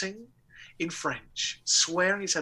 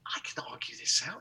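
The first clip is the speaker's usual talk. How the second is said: whisper; 6 semitones lower, 10 dB quieter than usual. Retold or imitated. Inner thought whispered.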